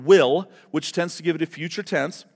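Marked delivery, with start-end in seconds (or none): 0.1-0.5 "will" hit noticeably louder; stress on will. Shown as a demonstration. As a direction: punch the word "will" so it stands out. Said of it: "Notice the, the little word".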